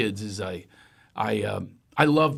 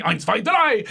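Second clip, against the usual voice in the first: German count; 8 semitones higher, 4 dB louder than usual. Shown as a demonstration. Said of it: "as I say in Germany".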